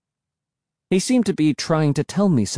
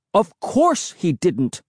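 slow, high, emphatic protest; crying out; 6 semitones higher, slower than usual. A narrator's reading. Defending Wolf to Emily, drawn-out high cry. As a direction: cry out, stretching the words. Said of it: "I cried".

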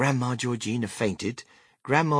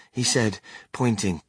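plain reading voice

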